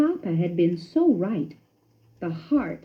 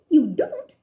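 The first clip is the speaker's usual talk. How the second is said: wondering question; 8 semitones higher, 3 dB louder than usual. A narrator's reading. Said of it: "Ced questioned in wonder".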